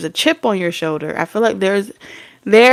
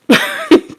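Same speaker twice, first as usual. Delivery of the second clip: high laugh; 3 dB louder than usual. Real, not acted. Laugh breaks into the quote.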